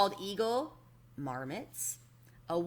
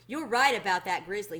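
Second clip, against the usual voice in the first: usual speaking voice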